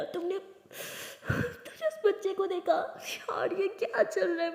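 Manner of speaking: high, slow tearful whisper; crying whisper. Acted. Aliya to her friend, whispering through tears in the car. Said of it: "ज़ोर से चिल्ला के रोने लगती है".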